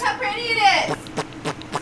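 high slow gushing; excited. Real, not acted. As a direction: gush over the flowers. Admiring flowers, gushing.

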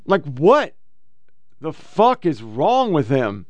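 ordinary talk, nothing marked

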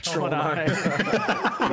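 drawn-out laughing repeat. Real, not acted. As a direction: stretch it out through laughter. Mocking the misheard title.

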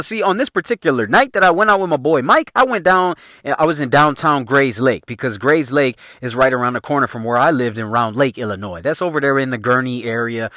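ordinary delivery

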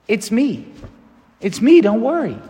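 a gentle, friendly "It's me, don't worry"; friendly reassurance. Retold or imitated. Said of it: "in that friendly way".

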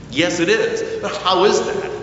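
normal talking voice

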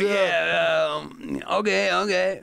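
high, drawn-out mimicked okays; imitated reaction. Comic retells a man's okays to host, high slow imitation. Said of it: "He was doing that".

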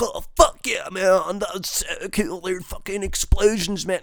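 slow, high, hammed-up dude voice, stretching out the man; dumb eighties action fan. A fan in the eighties raving about explosions, mock dude voice. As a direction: gush like a dazzled teen bro over explosions.

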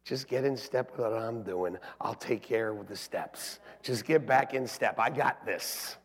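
lightly voiced, low and soft God; a hint of a calm, quiet divine voice; voice of the Lord. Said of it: "what the Lord said to me".

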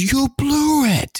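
high, slow, drawn-out "You blew it."; De Niro shouting. Retold or imitated. De Niro telling Stallone off in the Copland scene, high and drawn out. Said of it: "the scene with De Niro where De Niro gets, goes".

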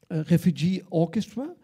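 ordinary talk, nothing marked

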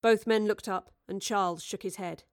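usual speaking voice

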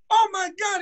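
very high excited cry; owner amazed. Retold or imitated. Owner thrilled after hearing him sing, high excited cry. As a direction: squeal it like he can't believe it. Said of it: "he goes".

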